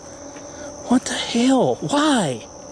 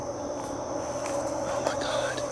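whispering; 13 dB quieter, much slower than usual. Real, not acted. Dark woods, creepy discovery.

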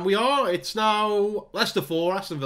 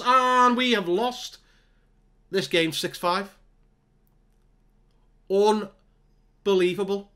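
slow, drawn-out, louder words of shock; stunned disbelief; 3 semitones higher, 3 dB louder, much slower than usual. Real, not acted. Gamer reacting to a last-minute defeat, slow stunned disbelief. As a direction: drag each word out in disbelief. Team loses in final minute.

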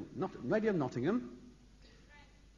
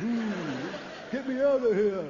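goldfish; 5 semitones higher, 6 dB louder than usual. Pretend. Voicing the goldfish.

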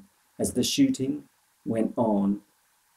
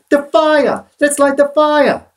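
high, loud urgent cry; urgent shout; 9 dB louder than usual. A narrator's reading. Boy urging the old man to light the fire, shouting. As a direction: shout the order, desperate to be seen. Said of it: "I cried".

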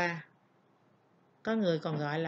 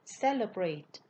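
"Celebrate" stressed as CE-lebrate; stress on first syllable. Shown as a demonstration. Teacher giving the verb to students, "Celebrate" stressed on the first syllable. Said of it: "ngữ điệu sẽ nhấn ở vần một".

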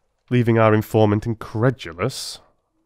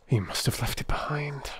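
softer, whispered aside to himself; muttered whisper; 6 semitones higher, 7 dB quieter than usual. A narrator's reading. Holmes mutters to himself while questioning, whispered. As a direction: breathe it out under your breath. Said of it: "muttered my companion".

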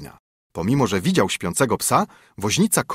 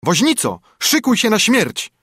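higher, louder threat; menacing call; 11 semitones higher, 4 dB louder than usual. A narrator's reading. Sparrow outside the window threatens the carter, calling loudly. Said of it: "wołał".

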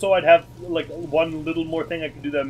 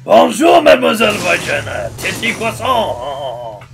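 mock French accent; 4 semitones higher, 11 dB louder, slower than usual. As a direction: shout it like a cartoon Frenchman. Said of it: "French stereotype".

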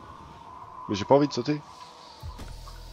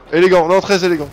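higher, louder, delighted; excited praise; 5 semitones higher, 8 dB louder than usual. Real, not acted. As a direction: cheer the move. Repeats it louder.

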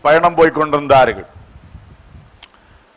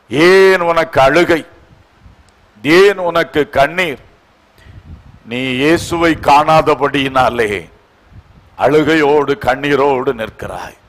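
gentle consoling; 4 semitones lower, slower than usual. Real, not acted. Preacher asking the weeping why they cry, gentle consoling.

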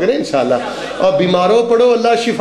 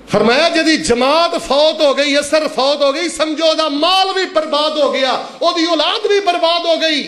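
high-pitched sermon warning; fervent preacher's raised pitch; impassioned preaching; 7 semitones higher than usual. Real, not acted.

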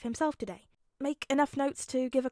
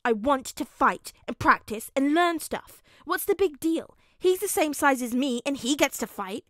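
put-on loud, higher-pitched child's tantrum; fuming; 4 semitones higher, 10 dB louder than usual. A narrator's reading. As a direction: argue hotly against the unfairness. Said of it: "Bakugo fumed".

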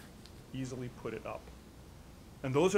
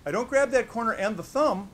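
don't grab said higher and louder, grab punched hard; stress on grab; 7 semitones higher, 8 dB louder than usual. Shown as a demonstration. Instructor correcting the common gloving mistake, emphatic. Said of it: "That would really gum things up".

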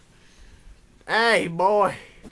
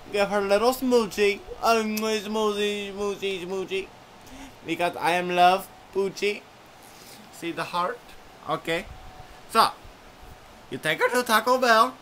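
bright, high little dog voice; lightly voiced cheerful dog; 4 semitones higher, 6 dB louder than usual. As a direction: greet them like a perky chihuahua. Chihuahua gives love advice.

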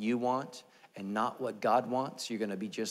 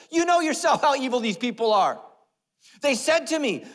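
high, loud wail of blame; mock indignant shout; 14 semitones higher, 9 dB louder than usual. Aaron blaming the people to Moses, mock indignant shout. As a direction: bellow the excuse, hammed-up.

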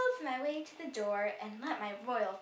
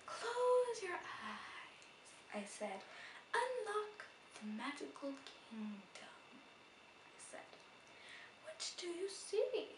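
soothing storyteller; 7 semitones higher, 7 dB quieter than usual. Acted. Soothing a scared friend.

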